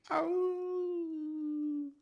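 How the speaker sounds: long, high imitated wolf howl. Mimicking the heroine's inner wolf, a drawn-out howl. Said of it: "wolf inside of her is like".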